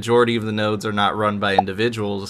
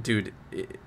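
normal talking voice

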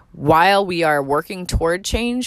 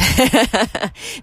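light, higher-pitched laugh; self-conscious chuckle; 8 semitones higher than usual. Real, not acted. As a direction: chuckle briefly at your own amateur effort. Chuckles at own amateur recording.

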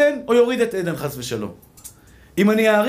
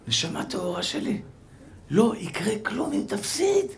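tender comfort; 2 semitones lower, 9 dB quieter than usual. Acted. Soothing an imagined backgammon loser.

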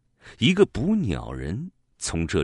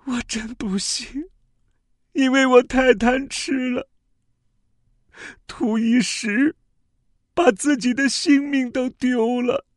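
regretful dying bird; 5 dB louder than usual. Acted. Dying bird's regretful last words.

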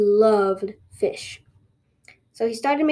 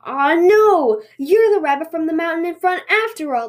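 higher, loud wail at first, then easing; dismayed scream; 6 semitones higher, 4 dB louder than usual. Acted. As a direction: wail in sudden horrified realisation. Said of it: "the tanuki screamed".

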